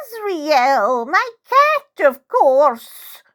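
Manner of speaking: put-on high, impatient old lady's huff; impatient old woman. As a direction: huff it high as if it's obvious. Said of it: "She harumphs impatiently".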